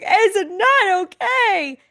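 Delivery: mock-distressed high question; high wail. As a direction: whine it up high like a hurt child. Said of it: "I'm like".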